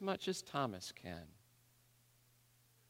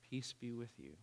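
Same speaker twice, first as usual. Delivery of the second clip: soft, quieter calm voice; calm, soft repeat; 12 dB quieter than usual. Acted. The surfer repeating peace to Brad, softer and calmer. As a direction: say it again gently, softer still. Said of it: "he says it again".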